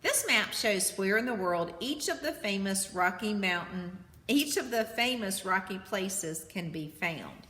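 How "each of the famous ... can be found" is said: usual speaking voice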